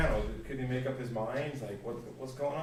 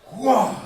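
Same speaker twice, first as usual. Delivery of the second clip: a much higher, much louder rising whoa; swelling whoa; 9 semitones higher, 16 dB louder than usual. Shown as a demonstration. Teacher showing forzando as a big whoa. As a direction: let the whoa bloom out. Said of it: "it's kind of a more".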